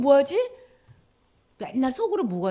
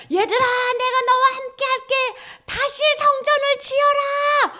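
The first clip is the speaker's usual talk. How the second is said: booming call; 9 dB louder than usual. Acted. God calling his people to rebuild the temple, shouting. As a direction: call out from the heavens.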